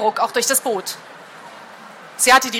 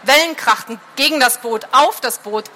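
plain reading voice

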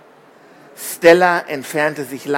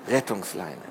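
ordinary delivery, nothing marked

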